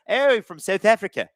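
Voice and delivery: put-on playful, half-hearted stab at a South African accent; attempted South African accent. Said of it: "I can't do an accent there".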